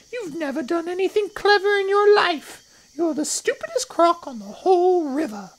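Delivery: very high, loud creature cry; scornful little crocodile. A narrator's reading. Not-so-big crocodile insulting the enormous one, high scornful cry. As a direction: berate in a tiny high-pitched voice. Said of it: "cried the not-so-big one".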